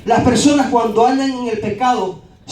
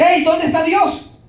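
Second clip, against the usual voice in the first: higher, slow challenging call; confrontational heckle; 8 semitones higher, slower than usual. Retold or imitated. Heckler in the street confronting the preacher, demanding. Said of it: "Siguió y me dice".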